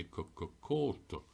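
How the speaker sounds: c, c, coat said slowly, one sound at a time. Shown as a demonstration. Teacher slowly sounding out the Irish word for coat. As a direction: spell out c, c, coat with a broad k. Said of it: "the C is a broad K".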